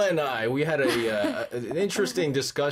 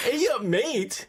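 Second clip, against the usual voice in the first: slow, higher mock Australian "Ay up, mate"; mock Australian accent; 7 semitones higher, slower than usual. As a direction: echo it back in a hammed-up Australian accent. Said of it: "That's Australian".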